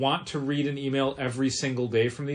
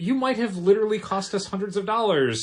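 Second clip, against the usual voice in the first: indignant; 8 semitones higher, 5 dB louder than usual. Retold or imitated. Acting out indignant store owner.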